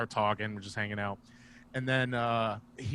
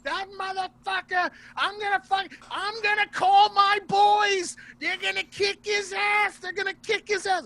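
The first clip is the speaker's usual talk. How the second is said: high, loud imitated raging yell; mock furious rant; 5 dB louder than usual. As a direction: shriek it up high like a man losing his mind. Sparta raging about his boys.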